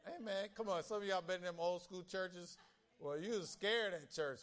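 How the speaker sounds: ordinary talk, nothing marked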